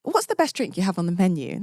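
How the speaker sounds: politely put-on British accent; very British, courteous request; extra British. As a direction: play up the British accent a little. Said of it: "I get that little bit more British".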